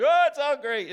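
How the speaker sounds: mock the fickle fans, loud and high. Mocking fickle Packers fans to the congregation, sarcastic and loud. Mocking fans' change of mood.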